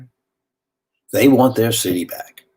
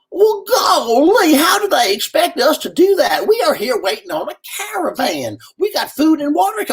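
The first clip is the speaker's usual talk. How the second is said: folksy volunteer lady; 4 dB louder than usual. Retold or imitated. Red Cross lady objecting to the stranded man, high folksy voice.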